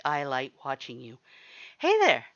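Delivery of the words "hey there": much higher, loud cheerful greeting; too loud. A narrator's reading. You greet the shy skeleton too loudly, a bright loud hello. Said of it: "said that a little too loudly".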